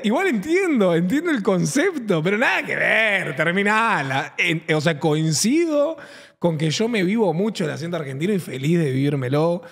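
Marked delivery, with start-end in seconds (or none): none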